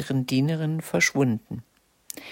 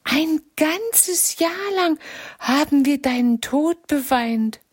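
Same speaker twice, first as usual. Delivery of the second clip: crying out; 12 semitones higher than usual. A narrator's reading. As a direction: cry it out in grief. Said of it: "rief sie".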